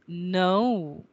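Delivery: NO drawn out with a gliding vowel; diphthong demo. Shown as a demonstration. Teacher shows how it is written, long gliding NO. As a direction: stretch NO from uh into oo. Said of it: "the way you write it is".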